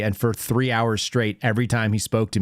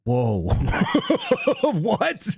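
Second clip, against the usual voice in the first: a shouted whoa, then a high laughing what; startled whoa; 9 semitones higher than usual. Retold or imitated. Dad retelling his reaction to his toddler pointing at a cross, startled and laughing. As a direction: caught off guard and cracking up.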